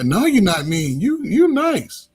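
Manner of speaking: high, slow mock student voice, rising in pitch; imitating students. Students telling their coach he's nice, in a high mock voice. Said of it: "they used to".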